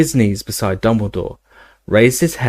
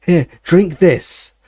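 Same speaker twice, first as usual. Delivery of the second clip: higher, pressing voice; desperate urging; 7 semitones higher, 4 dB louder, slower than usual. A narrator's reading. Harry coaxing Dumbledore to drink, raised desperate urging. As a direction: urge him on, voice pitched up in desperation. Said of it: "said Harry desperately".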